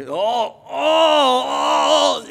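long rising groan, mimicking an old man bending over; hammed-up "oh" of effort; imitated straining groan. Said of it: "and he was doing that".

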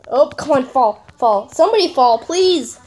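ordinary talk

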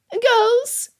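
very high-pitched motherly reproof; reprimanding. A narrator's reading. Mother cutting off her squabbling daughters, reprimanding. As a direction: call the children to order in a high, sharp pitch. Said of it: "Missus Bennet reprimanded".

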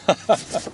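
quick breathy laugh; satisfied chuckle. Real, not acted. Angler whose day is made, soft satisfied chuckle.

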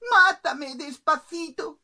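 high, slow singsong plea; pleading little bird. Acted. Bird begs the cook to kill gently, high slow plea. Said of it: "el pajarito dijo".

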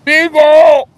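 loud, high-pitched yell of a name; shouted call. Pretend. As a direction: call out sharply to the dog. Calling out to the beagle.

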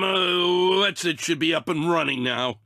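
ordinary talk, nothing marked

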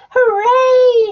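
loud, high cheering hooray; crowd cheer. A narrator's reading. The people of Popperville cheering the finished cellar, loud high cheer. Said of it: "shouted the people".

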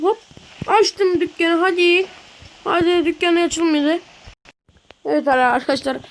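normal talking voice

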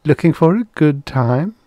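coy, high-pitched come-on; high, coquettish invitation; simpering. A narrator's reading. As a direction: flirt with a rising, teasing lilt. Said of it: "she simpered".

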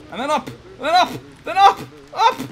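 building urgency. Real, not acted. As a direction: push harder with every up. Coaxing the character upward.

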